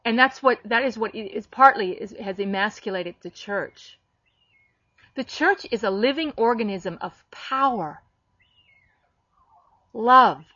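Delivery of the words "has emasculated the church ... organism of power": usual speaking voice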